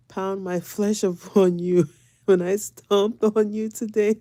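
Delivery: mock menace dissolving into laughs; laughing mock threat. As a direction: retell a threat while cracking up. Laughs retelling her own threat.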